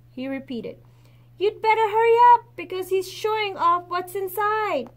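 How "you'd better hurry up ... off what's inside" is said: urgent call. Acted. Friend urging her to hurry.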